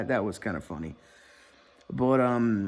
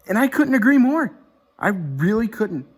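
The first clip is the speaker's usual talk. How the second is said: high loud emphatic agreement; 10 semitones higher, 5 dB louder than usual. Real, not acted. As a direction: really hammer the agreement.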